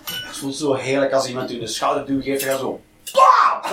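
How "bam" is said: sudden high-pitched burst; explosive mock-fight yell; acted punch exclamation. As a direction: shout it as the blow lands. Acting out a shoulder shove and punch.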